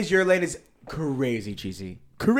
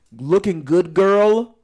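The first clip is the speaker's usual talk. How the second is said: louder, rising cheesy pickup line; mock it loud and rising; loud mocking quote; 4 semitones higher, 7 dB louder, much slower than usual. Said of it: "I feel like".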